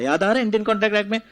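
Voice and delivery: ordinary delivery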